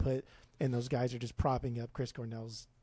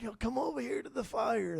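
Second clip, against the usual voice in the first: higher, put-on old grandpa storyteller; 9 semitones higher than usual. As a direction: call the kids over like a grandpa. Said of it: "That's my grandpa voice".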